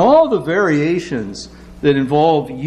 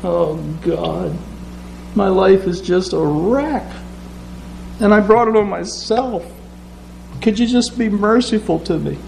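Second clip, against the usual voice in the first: humble sinner's plea; 5 semitones higher than usual. Acted. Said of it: "he kinda".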